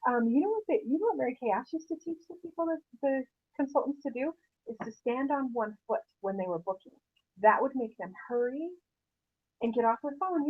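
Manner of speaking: normal talking voice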